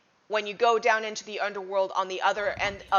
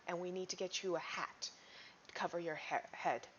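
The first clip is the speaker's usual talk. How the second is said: much softer, hushed warning; hushed urging; 10 dB quieter than usual. Acted. Warning the strangers to take cover, hushed.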